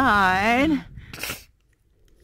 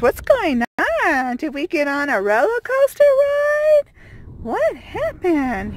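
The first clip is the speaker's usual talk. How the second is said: high, excited voice for the dog Milo; voicing the dog; 7 semitones higher, 4 dB louder than usual. Owner voicing her dog Milo about the shaking van, high and puzzled. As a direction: speak for the puzzled dog, pitched up. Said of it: "He says".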